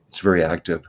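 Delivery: ordinary talk, nothing marked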